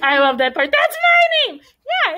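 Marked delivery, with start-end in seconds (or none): 0.7-1.6 very high, loud imitation; mock outcry. Teacher re-enacts favourite story line. 1.6-2.2 high-pitched imitated yes; mock eager agreement. Teacher re-enacts the reply.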